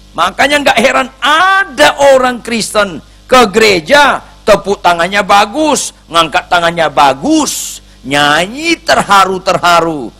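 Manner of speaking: high, loud pulpit preaching; fiery preaching. Acted. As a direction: drive the message home with force. Preaching at full force.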